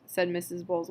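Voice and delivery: plain reading voice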